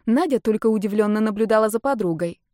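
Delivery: usual speaking voice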